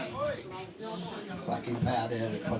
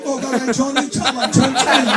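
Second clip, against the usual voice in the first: mock Chinese accent. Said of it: "You imagine saying to".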